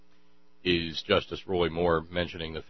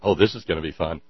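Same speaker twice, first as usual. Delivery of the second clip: ordinary delivery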